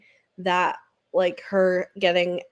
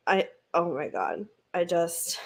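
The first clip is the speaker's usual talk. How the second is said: ordinary talk, nothing marked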